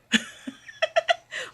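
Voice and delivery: breathy high-pitched giggle; high laugh. Real, not acted. Grandmother laughing at her friend's saying, high giggle. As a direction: let a high giggle escape.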